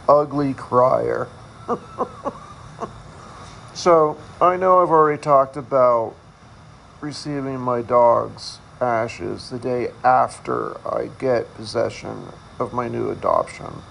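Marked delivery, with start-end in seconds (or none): none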